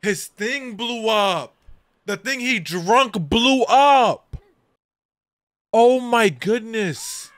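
high, loud, slow disbelieving yell; stunned shouting. Real, not acted. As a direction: wail it out in shock. Stunned reaction to explosion.

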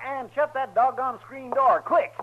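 loud, slow shouted order; urgent call. Acted. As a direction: bark it at the door before flies get in. Flies, door left open.

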